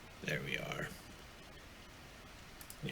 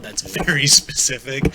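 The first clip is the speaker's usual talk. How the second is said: laughs mid-line, louder and higher; laughing at himself; 5 semitones higher, 10 dB louder than usual. Real, not acted. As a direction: chuckle through the words. Laughs at his own prediction.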